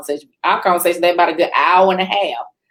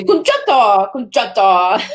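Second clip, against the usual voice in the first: put-on loud French-accented name call; mock French accent, punched out loudly; heavy French accent; 3 semitones higher, 4 dB louder, slower than usual. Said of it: "she had a heavy French accent".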